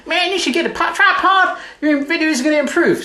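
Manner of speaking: high-pitched mock voice of viewers; squeaky put-on commenter voice; nagging viewer. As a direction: imitate fans pestering with advice. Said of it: "A lot of you have been saying".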